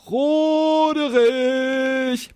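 long, drawn-out roar of a name. A narrator's reading. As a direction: bellow the name slowly across the cave. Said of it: "brüllte der sechsköpfige Drache".

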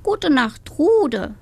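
high drowsy hen reply; sleepy hen voice. Acted. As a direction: answer like a tired little hen. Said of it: "antwortete Agathe".

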